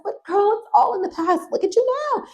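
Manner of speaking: high, sing-song condescending lady; Missus Fish's sweet voice. Retold or imitated. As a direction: coo it sweetly with a hidden jab. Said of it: "she talks in this octave".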